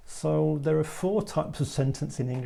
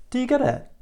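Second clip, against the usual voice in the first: high question, pitch change on "it"; inflection on last word; 11 semitones higher, 4 dB louder, faster than usual. Shown as a demonstration. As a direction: ask high, inflect the final "it". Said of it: "inflection or change in pitch on the last word".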